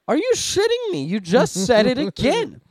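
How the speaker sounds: high, loud retold disbelief; exasperated outburst. User catches AI repeating the banned phrase, exasperated outburst. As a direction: yell it in mock outrage at a chatbot.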